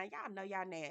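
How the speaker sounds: normal talking voice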